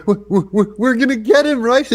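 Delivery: loud, high, stammering excitement; mock a manic, over-eager schemer; Michael Cohen flipping out. Host mocking a witness flipping out on a podcast, manic. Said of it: "flipping out on a podcast".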